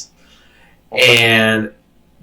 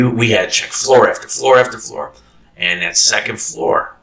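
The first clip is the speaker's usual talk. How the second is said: ordinary delivery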